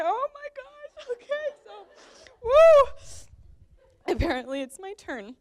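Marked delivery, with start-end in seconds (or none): 0.0-1.7 very high squeal, half laughing through tears; tearful, overwhelmed. Real, not acted. Sister after everyone got teary-eyed, tearful and overwhelmed. 2.4-4.1 loud, high "woo" to shake off tears; high loud whoop. Real, not acted. Sister pulling herself together mid-toast, high loud whoop.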